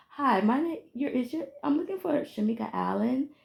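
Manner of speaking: high, soft sugary caller voice; condescending caller. Retold or imitated. Imitating the female caller, soft and high. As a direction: sweet-talk her like a child. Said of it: "they're gonna be like".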